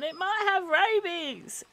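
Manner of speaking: mock-panicked cry of warning; retold niece's scream. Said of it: "my niece screamed and said".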